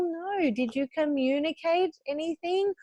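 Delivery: normal talking voice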